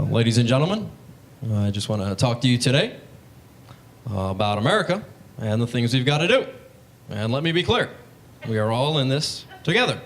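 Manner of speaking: measured presidential oratory, phrases broken by pauses; Obama impression. President addressing the nation, halting mock-oratory impression. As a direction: give a halting speech, phrase endings swooping up. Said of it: "really cool to see Obama".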